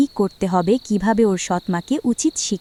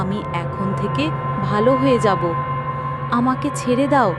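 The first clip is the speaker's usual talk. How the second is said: mildly raised pleading; begging stepmother. Acted. Stepmother begs the witch not to kill her, mildly frightened. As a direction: plead for mercy, a touch scared.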